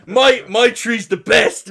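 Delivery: loud, slow, dim-witted ape grunt; dumb caveman voice. Retold or imitated. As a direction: play a brainless caveman boasting. Mocking hooligans as apes.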